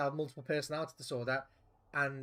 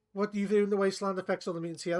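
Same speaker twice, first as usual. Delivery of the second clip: normal talking voice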